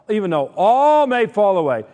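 "all" proclaimed loud and high; emphatic. Retold or imitated. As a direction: stress on "all", held long, high and loud. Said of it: "I want to emphasize".

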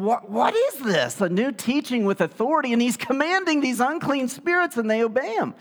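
a stunned onlooker asking what this is; amazed. Retold or imitated. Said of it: "they were amazed".

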